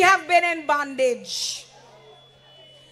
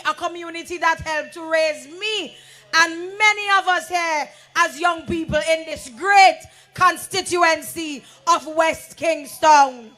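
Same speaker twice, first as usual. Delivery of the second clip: ordinary talk, nothing marked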